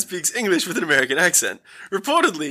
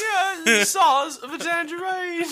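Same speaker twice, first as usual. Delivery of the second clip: high, slow mock British with laughter; bad British accent; 10 semitones higher, slower than usual. Spoofing failed accent tapes, laughing mock British accent. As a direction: stretch it out in a silly British accent. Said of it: "Him trying to do a British accent".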